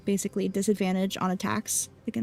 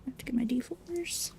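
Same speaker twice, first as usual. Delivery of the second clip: soft whisper to herself; 4 semitones higher, 7 dB quieter than usual. Real, not acted. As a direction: mutter it under her breath. Whispers while fetching dice.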